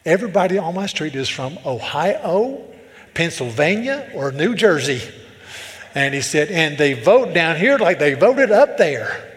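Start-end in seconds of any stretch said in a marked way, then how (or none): 0.0-5.0 loud imitated Southern complaint; thick Southern accent. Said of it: "this guy was thick". 6.5-9.3 loud, slow Southern punchline; thick Southern accent. Retold or imitated. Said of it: "this guy was thick".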